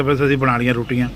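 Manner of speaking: ordinary talk, nothing marked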